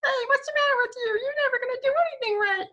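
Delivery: very high squeaky little voice; tiny toe voice. Shown as a demonstration. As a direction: squeak like a tiny cartoon critter. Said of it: "now it's coming from your little pinky toe saying".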